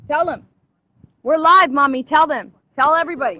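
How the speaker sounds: loud call that they're live; loud urging. Real, not acted. Mother telling her daughter they are live, loud. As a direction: shout it out to her.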